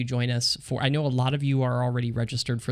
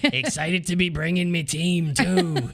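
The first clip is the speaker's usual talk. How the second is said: hammed-up pirate, higher and slower; 5 semitones higher, slower than usual. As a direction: growl it like a pirate. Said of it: "saying it like a pirate".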